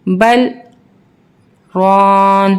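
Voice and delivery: بل then a held silent break before رون; pause for saktah. Shown as a demonstration. Teacher to children, saktah pause between بل and رون. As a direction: freeze like a statue between بل and رون, breath held on. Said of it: "بیچ میں تھوڑا سا break لینا ہے".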